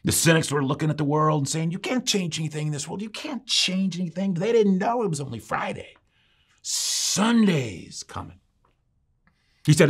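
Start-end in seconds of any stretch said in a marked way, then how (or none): 0.0-8.4 higher-pitched, building preacher's fervour; preaching picked up a little. Retold or imitated. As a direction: imitate a preacher warming up, pitch climbing. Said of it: "he picked up the volume a little bit".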